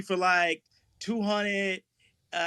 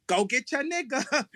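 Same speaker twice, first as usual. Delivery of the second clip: quoted order; 5 semitones higher than usual. Retold or imitated. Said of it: "they were like".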